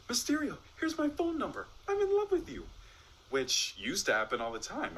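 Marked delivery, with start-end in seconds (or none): 0.1-2.7 very high-pitched swooning fan; smitten audience member. Acted. Magician imagines an impressed fan calling to him, smitten falsetto. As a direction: squeal it like an adoring admirer. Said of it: "No one to shout".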